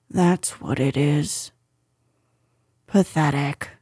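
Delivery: low, glum donkey voice; gloomy donkey. A narrator's reading. Old donkey judging his reflection, gloomy. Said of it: "said Eeyore gloomily".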